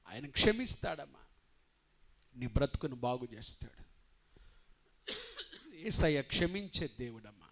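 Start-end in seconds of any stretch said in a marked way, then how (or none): none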